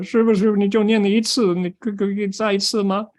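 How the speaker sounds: high-pitched, eager pleading; eager disciple. Acted.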